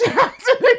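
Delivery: loud high burst of laughter. Real, not acted. Laughing at his mate's shocked reply, high burst. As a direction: let the laugh explode upward.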